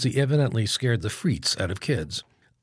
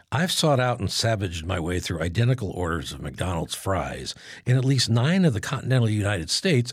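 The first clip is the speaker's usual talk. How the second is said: ordinary talk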